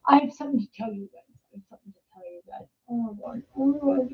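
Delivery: very fast, dropping almost to nothing; hushed confession. Real, not acted. Teller to live viewers about a bad deed, hushed confession. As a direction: rush it out under your breath.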